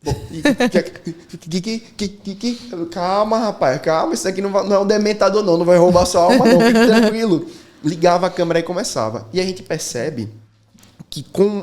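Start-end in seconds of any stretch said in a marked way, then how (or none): 0.0-2.9 imitated stuck stammer; mock camera-fright stutter; freezing on camera. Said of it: "pessoas que travam na frente de uma câmera". 2.9-7.5 loud, higher, exasperated calming down; playful, raised-voice "relax, man" delivery; mock reassurance. Jokingly calming a frozen client.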